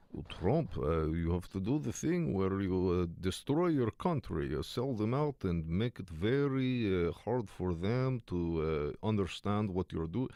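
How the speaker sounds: slow, heavy mock Russian accent, voicing Putin; lightly hammed Russian accent, drawn out and slow. Commentator imitating Putin on a burner phone, slow mock Russian accent. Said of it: "my Russian is sounding so Count Chocula".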